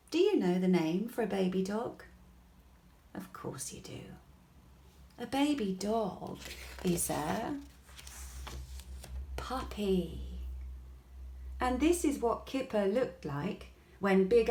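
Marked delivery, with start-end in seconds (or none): 3.1-4.0 whispered aside. A narrator's reading. Narrator to listening child. 5.2-10.4 whispered reveal. A narrator's reading. Narrator reveals the answer.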